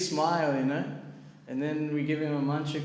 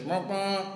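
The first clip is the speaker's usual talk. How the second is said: higher-pitched honking horn noise; vuvuzela horn imitation; 6 semitones higher than usual. Book seller blowing his horn in the carriage, imitated honks. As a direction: honk twice like a vuvuzela. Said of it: "he blows his vuvuzela".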